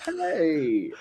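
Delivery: very high, fast, cracking Harry Carey; Will Ferrell's Harry Carey. Retold or imitated. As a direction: shout it like a giddy old broadcaster. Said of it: "Will Ferrell doing Harry Carey".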